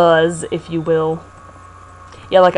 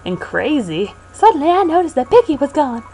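Pokey voice; 9 semitones higher than usual. Acted. Voicing Pokey character.

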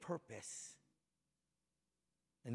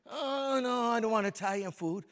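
higher, louder mock refusal; family refusing; 8 semitones higher, 8 dB louder than usual. Preacher mimics his family refusing Italian food, higher and louder. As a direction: exaggerate their no.

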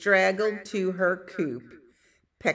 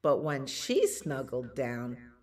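ordinary delivery

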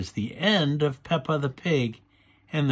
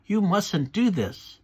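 higher-pitched, bossy scolding; 8 semitones higher than usual. A narrator's reading. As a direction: lay down the rule like a bossy child. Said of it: "in a bossy voice".

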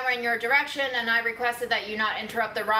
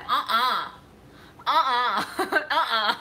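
laughing refusal; slower than usual. Real, not acted.

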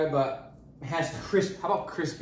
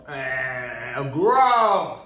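disgusted by taste; 5 dB louder than usual. Real, not acted. Tasting bad melon.

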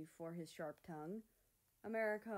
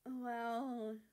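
nervous; 5 semitones higher, 4 dB louder than usual. Acted. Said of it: "America chuckled nervously".

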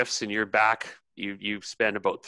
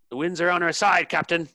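calling out; 8 semitones higher, 3 dB louder than usual. Acted. Crewman calls out to captain.

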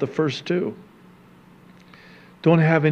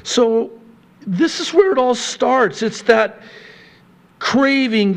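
higher, insistent sermon voice; impassioned preaching; 6 semitones higher than usual. Acted. Pastor warning the church about covetousness, raised preaching. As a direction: drive the warning home like a preacher.

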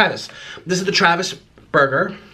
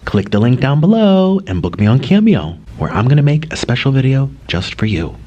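louder, lower pitched promo pitch; ad read; 3 semitones lower, 5 dB louder than usual. Acted. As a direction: sell it to the viewers like a commercial. Cameo plug to viewers.

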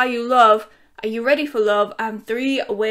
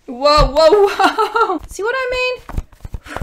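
louder, higher laughing whoa; 7 semitones higher, 7 dB louder, slower than usual. Real, not acted. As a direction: laugh as the dog jumps up. Dog climbs on her lap.

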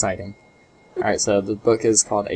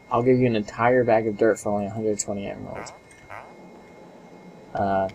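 normal talking voice

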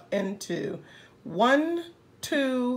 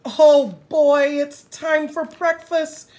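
excited rabbit; 3 semitones higher, 7 dB louder than usual. Acted.

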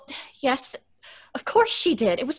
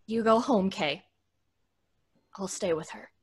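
quiet resolve; 12 semitones lower, 7 dB quieter than usual. Acted.

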